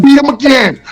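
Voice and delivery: imitating the girlfriend siding with his opponent; egging-on girlfriend. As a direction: cheer the opponent on at him. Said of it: "she's like".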